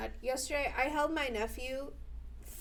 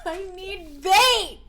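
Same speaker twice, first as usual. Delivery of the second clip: drawn-out loud complaint; loud slow demand; 6 semitones higher, 7 dB louder, much slower than usual. Real, not acted. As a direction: stretch it out loudly. Yelling at game, slow.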